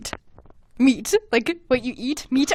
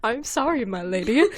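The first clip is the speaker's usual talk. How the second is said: mock gentleman; 3 semitones higher, 3 dB quieter than usual. Mimicking gentlemanly apology.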